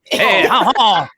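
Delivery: slow, high hammy Cajun drawl; a bad Cajun impression; bad Cajun accent. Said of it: "with his bad Cajun accent".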